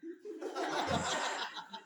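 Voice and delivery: long breathy laugh; real laughter. Real, not acted. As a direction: laugh at your own story. Laughs at own story.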